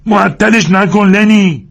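high, loud, slow call; drawn-out shout to Lennie; shouting through cupped hands. Acted. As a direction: call out across the fight, hands round mouth. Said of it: "دستش را دور دهان گرفت و فریاد زد".

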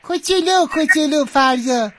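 put-on high, tiny little-kid voice; little child voice. Shown as a demonstration. Host showing the contestant how to sound smaller, little child voice. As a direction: make it teeny and high-pitched. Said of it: "حالا یه ذره کوچولوترش کن".

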